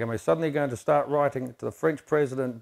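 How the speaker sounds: ordinary delivery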